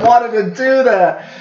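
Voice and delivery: laughing through the words, slow and high; half-laughing confession. Real, not acted. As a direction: giggle it out. Laughing at his own idea.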